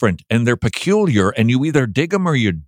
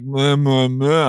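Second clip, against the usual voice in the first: bored drone; 2 semitones higher, slower than usual. Retold or imitated. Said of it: "reading it like".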